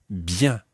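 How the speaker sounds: "bien" ending on a nasal vowel; nasal French bien. Shown as a demonstration. Teacher introduces the word for well, "bien" nasal. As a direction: say "bien" with its end through the nose, no hard n. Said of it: "is another nasal sound".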